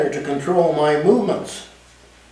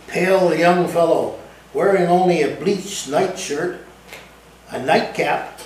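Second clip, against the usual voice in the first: plain reading voice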